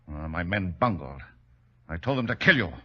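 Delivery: ordinary talk, nothing marked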